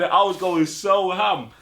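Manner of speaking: usual speaking voice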